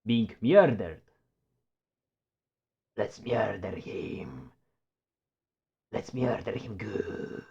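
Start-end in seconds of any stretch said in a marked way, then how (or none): 3.0-7.4 slow creepy whisper; sinister whisper. Acted. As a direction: whisper a slow villainous plot. Sinister plotting.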